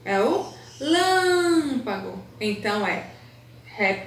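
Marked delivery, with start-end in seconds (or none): 0.8-2.2 lâmpago with LÂM sung out high and very long; sing-song stress. Shown as a demonstration. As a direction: hold the strong LÂM as if singing. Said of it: "você fala com mais intensidade". (lâmpago com o LÂM cantado e alongado)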